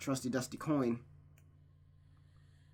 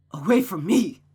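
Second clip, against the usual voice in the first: loud, high shout to drive them off; angry shout; 5 semitones higher, 11 dB louder than usual. Acted. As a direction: bark it at them to get away. Mother drives someone away.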